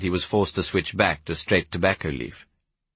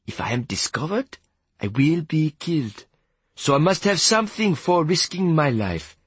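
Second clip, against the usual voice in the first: higher-pitched character voice, measured phrases; wheedling prisoner; 6 semitones higher than usual. Acted. Prisoner justifies his cut for risking his life, wheedling. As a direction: plead your case to the boss in careful phrases.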